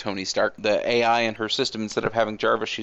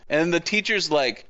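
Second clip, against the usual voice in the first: ordinary talk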